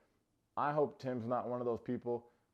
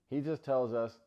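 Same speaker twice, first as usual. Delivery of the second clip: ordinary talk, nothing marked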